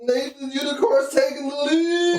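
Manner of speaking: excited race commentary; race announcer. Pretend.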